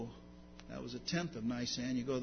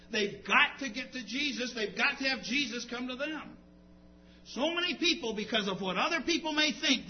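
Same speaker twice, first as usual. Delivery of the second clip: raised, emphatic pulpit voice; driving sermon delivery; impassioned preaching; 10 semitones higher, 6 dB louder than usual. Real, not acted. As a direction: preach it urgently, pitched up. Preacher builds to forceful exhortation.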